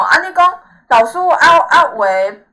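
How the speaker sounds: usual speaking voice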